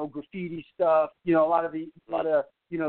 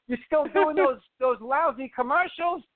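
Mel Brooks impression; 10 semitones higher than usual. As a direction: rib him like a roast comic. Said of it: "does a comedy routine on my dad".

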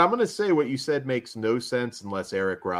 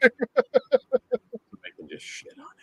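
high, slow whispered aside; 10 semitones higher, slower than usual. Real, not acted. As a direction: whisper it like a secret.

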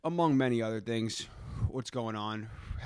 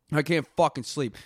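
ordinary delivery

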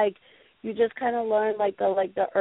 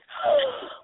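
sharp high gasp of surprise. Retold or imitated. Cashier Erica spotting Jason at checkout, sudden high gasp. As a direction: gasp as if starstruck. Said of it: "Erica goes".

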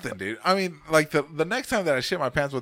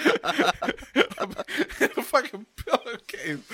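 high, breaking into laughter; can't get it out for laughing; 10 semitones higher than usual. Real, not acted. Laughing at his own story.

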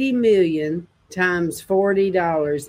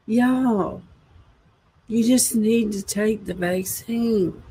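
insincere Biden impression; 5 dB quieter, slower than usual. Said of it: "the way that he talks just seems, uh, insincere".

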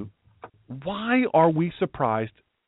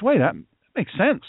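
a hint of a won-over frog, pitched up; frog being persuaded; 5 semitones higher than usual. Frog comes round to the scorpion's argument, lightly acted higher agreement. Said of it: "Well, the frog says".

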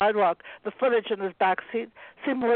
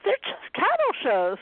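insistent; 9 semitones higher than usual. A narrator's reading. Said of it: "repeated Anna insistently".

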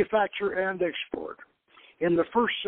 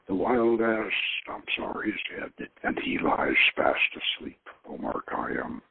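low, hushed reading of verse; low, half-whispered reading of a Khayyam quatrain; poem recital; 10 semitones lower than usual. Acted. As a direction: recite it like an old epitaph. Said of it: "preliminary poetry".

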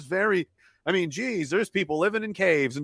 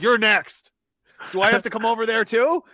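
pretend menace; 8 semitones higher, 4 dB louder than usual. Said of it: "Be like".

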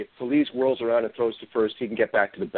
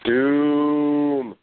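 ominous doom; 2 semitones higher, 5 dB louder than usual. Acted. Gloomy news, ominous doom call.

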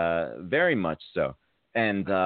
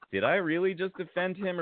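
worried inner question; 9 semitones higher than usual. Retold or imitated. Retold inner worry.

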